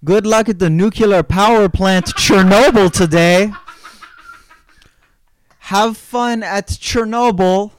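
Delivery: wife sending husband off, sing-song; wife's cheery farewell. Retold or imitated. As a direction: wave him off brightly to work. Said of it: "the last line the wife says".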